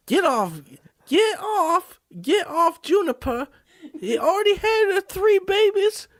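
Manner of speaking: very high, frantic shooing; shrieking cat lady. Acted. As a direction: shoo the cats off in a squeak. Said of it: "I am a crazy cat lady".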